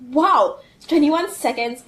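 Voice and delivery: wow, loud and thrilled; excited. Real, not acted. Amazed at the boot time.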